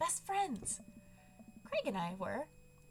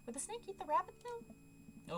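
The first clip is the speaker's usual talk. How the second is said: rising worried question; 9 semitones higher than usual. Acted.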